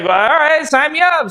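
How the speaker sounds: high, keen sign-up; eager yes. Retold or imitated. Telling Fleetwood he'll be a dealer, eager and high. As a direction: jump at the offer, pitch way up. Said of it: "I was like".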